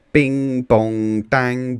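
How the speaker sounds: loud, bouncy sound-effect words; playful loud clunks for children; clanging car noises. A narrator's reading. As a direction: bang them out like metal hitting road. Car bouncing through a pothole.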